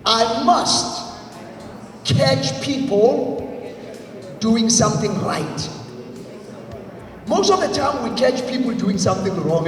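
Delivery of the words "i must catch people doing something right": slow, spaced phrases for echo; slow call and response. Shown as a demonstration. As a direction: lead the crowd line by line. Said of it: "Repeat after me".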